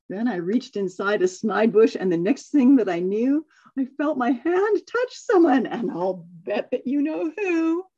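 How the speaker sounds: storybook reading, lively and even; story narration. Acted. Reading a Seuss story to the class, storyteller voice. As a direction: read the tale to the group like a picture book.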